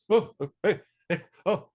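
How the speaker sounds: high, jolting mock wake-up; startled awake. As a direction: jerk awake from nodding off, acted out. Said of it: "I nodded off a time or two".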